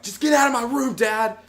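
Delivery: put-on high, loud kid's yell; acted angry shout. Crying kid yelling at dad, high angry shout.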